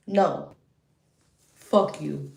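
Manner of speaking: normal talking voice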